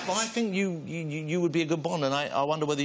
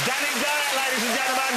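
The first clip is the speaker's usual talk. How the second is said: loud, high showman intro; announcer call; 10 semitones higher, 3 dB louder than usual. Real, not acted. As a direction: present him to the crowd. Host announces guest.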